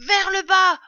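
high, very loud command; shouted order. A narrator's reading. Father fox orders family to dig down, shouting. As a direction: order the diggers with urgency. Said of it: "ordonna maître Renard".

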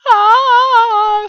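long, high, loud "aah"; breathless cub's gasp. A narrator's reading. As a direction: wail out after a burning gulp. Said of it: "le souffle coupé". (long cri aigu, souffle coupé)

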